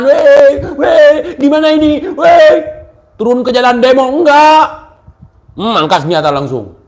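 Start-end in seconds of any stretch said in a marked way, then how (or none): 0.0-2.5 higher, louder mock panic cries. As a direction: ham up a helpless panicking crowd. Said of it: "Nggak cuma". (panik pura-pura yang dilebih-lebihkan)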